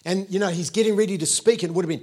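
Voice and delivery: usual speaking voice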